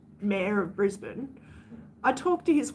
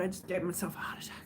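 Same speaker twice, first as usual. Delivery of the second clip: quick startled whisper under her breath; breathless whisper; 3 semitones lower, 8 dB quieter, much faster than usual. Real, not acted. Startled by something, whispering to her viewers. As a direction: hush it out fast after a fright.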